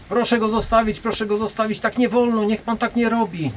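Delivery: high, pleading woman's voice. Retold or imitated. Bouncer imitates the woman begging him to let go, high and pleading. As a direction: mimic a fussy woman begging him to stop.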